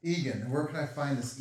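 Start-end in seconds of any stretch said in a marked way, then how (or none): 0.0-0.0 laughing through the words, high and breathy. Real, not acted. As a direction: giggle it out, barely voiced. Laughing table banter.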